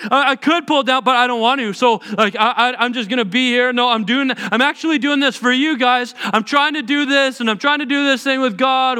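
high, loud flustered protest; pleading self-defense. Pretend. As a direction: beg them to listen, defensive. Jesus imagined defending himself.

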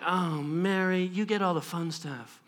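low, soft grumbling complaint; mock envy. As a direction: sulk about being passed over, hammed-up. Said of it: "she's not like".

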